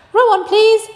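high, loud call; brisk shouted command; called-out order. Acted. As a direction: shout it to the rowers. Wonka calling to the rowers.

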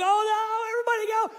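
high, loud panicked yell to the friends; panicked shout. Retold or imitated. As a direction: yell at everyone to run. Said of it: "I was like".

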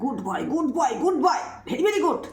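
high sing-song praise; rising high praise for the dog; squeaky praising a dog. Real, not acted. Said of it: "ধরে ধরে খাইয়ে দিতে হচ্ছে আবার good boy".

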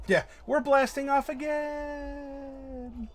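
high, slow, far-off wail; cartoon villain wail. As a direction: wail it as you fly off into the sky. Team Rocket quote.

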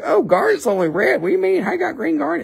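high, swooping comic voices; mock argument. Know-nothing buyer disputing green garnet, high mock squabble. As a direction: squawk it like a silly squabble. Acts argument with people ignorant of stones.

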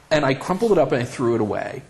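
ordinary talk, nothing marked

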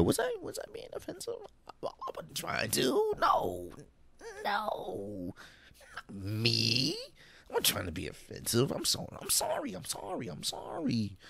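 tiny falsetto whimper, mostly soft; mock innocence. Host mimics offender turning to co-host, mock-innocent whimper. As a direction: play the wide-eyed offender in a squeaky little voice. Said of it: "someone's being offensive when they turn to, like, their co-host".

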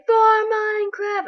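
high, slow, sung-out cry; mock battle cry. Imitating what Minecraft would say, high sung cry. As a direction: chant it like a silly war cry. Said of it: "they would say".

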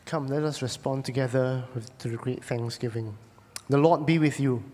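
ordinary delivery

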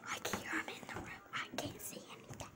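quick breathy whisper, hiding; whispering while hiding. Real, not acted. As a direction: keep it a hushed whisper. Kids hiding in the room.

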